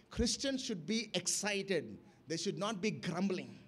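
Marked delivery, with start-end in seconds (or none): none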